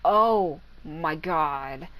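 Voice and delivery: slow, drawn-out meme "Oh my god"; quoting the meme, stretched out slowly; meme quote. Retold or imitated. Gamer quoting a meme to viewers, slow and drawn out. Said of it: "It's like that meme".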